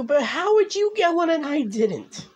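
real envious protest; pleading, slowed disbelief; jealous complaint. Real, not acted.

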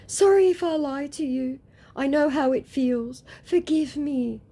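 high, loud mock apology; pleading scammer. Scammer begging the woman's pardon, mock pleading. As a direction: beg forgiveness in a high put-on voice.

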